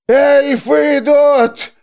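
high, loud, slow prophetic wail. A narrator's reading. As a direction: wail the warning out. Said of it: "завопил мужчина".

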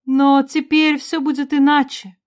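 high, emotional mother's voice; acted tearful hope; tearful, sobbing. A narrator's reading. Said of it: "всхлипнула Ванора".

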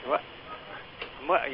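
ordinary talk, nothing marked

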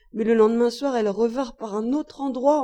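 ordinary delivery

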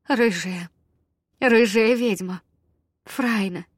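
ordinary delivery, nothing marked

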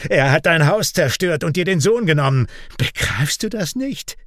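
high-pitched, contemptuous taunting; scornful. A narrator's reading. Raik scorning Kian's gods, mocking. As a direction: deride him and his faith. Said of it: "spottet er".

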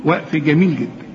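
ordinary talk, nothing marked

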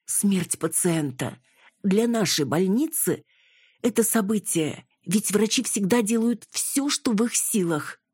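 grave nurse. A narrator's reading. Nurse tells detective of patient's death, earnest.

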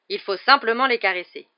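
stating the obvious. A narrator's reading. Hagrid explaining the books, as if utterly obvious. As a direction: say it as the most evident thing. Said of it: "comme si c'était la chose la plus évidente du monde".

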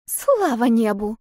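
mild, drawn-out squeak. A narrator's reading. Relieved younger sister on hearing she is no witch, mild squeak. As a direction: squeak it lightly, slowly. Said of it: "пискнула Гвенни".

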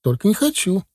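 put-on high, stubborn little-boy refusal; proud. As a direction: refuse with childish pride, voice up. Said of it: "ответил паренёк гордо". (гордый мальчишеский отказ)

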